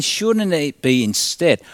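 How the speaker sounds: normal talking voice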